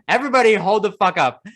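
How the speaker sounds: high, loud call to stop everything; incredulous outburst. Retold or imitated. Hearing the old woman's nine eleven line, loud incredulous outburst. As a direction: halt the room in disbelief. Reaction to outrageous remark.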